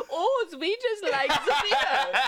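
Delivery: high-pitched, harmless cute German; high comic German beer-lover voice; adorable German. Acted. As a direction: play the Germans as sweet and silly. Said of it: "It's adorable now".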